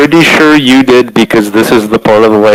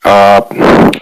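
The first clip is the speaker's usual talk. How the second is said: thin mobile phone audio; talking through a phone mic; 8 semitones higher than usual. Real, not acted. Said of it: "I'd like to apologize for my mic quality because I'm here on my mobile phone".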